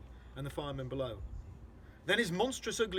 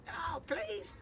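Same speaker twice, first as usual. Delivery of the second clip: a much higher, thinner "oh, please"; desperate spider plea, higher; higher pleading; 11 semitones higher than usual. Acted. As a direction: beg harder, pitch rising. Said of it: "Miss Spider called out".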